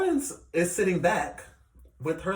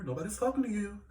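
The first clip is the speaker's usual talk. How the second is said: softer offhand mimicry of a castmate; imitating castmate's remark; 2 semitones higher, 6 dB quieter than usual. Vlogger mimics castmate butting in out of nowhere, softly tossed off. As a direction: toss it out quietly, quoting her. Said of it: "She did that quite a few times".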